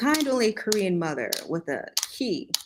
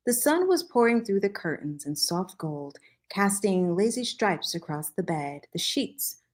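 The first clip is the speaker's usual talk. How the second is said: ordinary delivery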